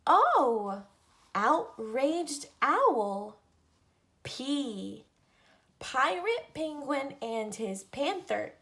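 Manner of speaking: lively picture-book read-aloud, each letter set apart; reading to children. Acted. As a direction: read an alphabet book to little kids. Reads alphabet book to children.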